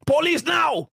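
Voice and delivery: high, shouted alarm; hammed-up clerk yelling for the cops; a panicked shopkeeper yells to call police; mock frantic yell.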